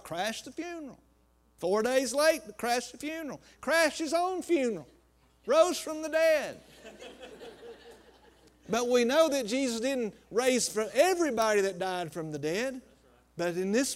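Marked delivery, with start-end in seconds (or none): 0.0-10.9 rising, rolling preacher's cadence; full-force preaching. Acted. Pastor preaching the miracle to his church, full force. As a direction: preach it out to the congregation.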